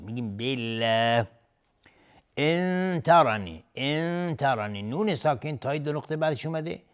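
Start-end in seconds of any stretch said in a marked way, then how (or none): 0.4-1.3 بالله with a light L and long front vowel; thin Allah. Shown as a demonstration. Teacher giving the correct form, بالله pronounced thin and light. 2.4-4.8 slow ان ترنی, holding a nasal hum on the n of ان; ikhfa. Shown as a demonstration. Teacher demonstrating the rule, ان held as a nasal hum. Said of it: "اخفای حقیقی".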